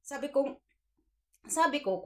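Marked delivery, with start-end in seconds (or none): none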